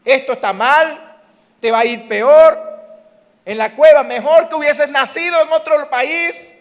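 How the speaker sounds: loud, high, drawn-out taunting shout; voz del enemigo. Acted. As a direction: shout doom at the listener, high and slow. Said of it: "¿A cuánto le ha llegado esa voz?".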